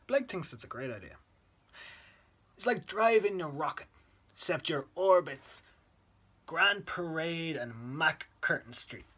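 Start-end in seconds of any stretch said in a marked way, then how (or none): 2.6-9.1 loud, lifted, thrilled boast; excited child. Acted. Boy to his friend about driving a bus, excited. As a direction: let the words launch like a rocket, high and loud.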